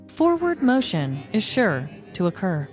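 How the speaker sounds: ordinary delivery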